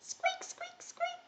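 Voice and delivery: tiny, high mouse squeaking; mouse squeaks. Shown as a demonstration. Teacher shows children the mouse cue, tiny high squeaks. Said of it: "when you hear mouse".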